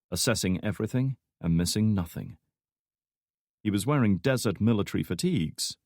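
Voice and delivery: ordinary delivery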